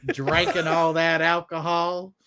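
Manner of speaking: ordinary talk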